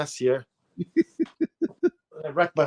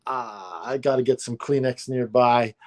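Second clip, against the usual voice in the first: ordinary talk, nothing marked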